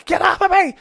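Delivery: loud, very high mock scream; imitated frantic screech. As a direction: shriek like someone being dragged out. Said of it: "she's kicking and screaming".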